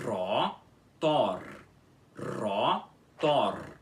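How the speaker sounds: "Rotor" with both Rs trilled, said twice; both Rs rolled. Shown as a demonstration. Teacher modelling the word with two rolled Rs. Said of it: "com os dois R's enrolados".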